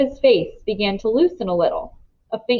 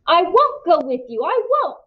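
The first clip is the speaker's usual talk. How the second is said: desperate protest; 9 semitones higher than usual. A narrator's reading. Winnie resisting being dragged.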